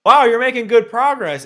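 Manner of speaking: high, loud mock Gojo greeting; mock cheery ambush voice; imitating Gojo. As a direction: pop up behind him cheerfully. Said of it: "Gojo literally says".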